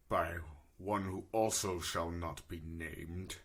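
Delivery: low, measured tale-telling in character; solemn war-orphan adventurer. Acted. Player tells character's backstory.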